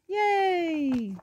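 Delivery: long, high "yay"; playful celebration for a child; happy cheer. Acted. Cheers for everyone jumping.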